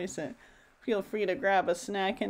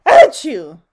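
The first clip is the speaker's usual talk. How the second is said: explosive mock sneeze; loud acted sneeze; 16 dB louder than usual. A narrator's reading. Mister Sneeze meets Little Miss Bossy, loud sneeze. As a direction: burst out with a big fake "achoo". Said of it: "sneezed Mister Sneeze".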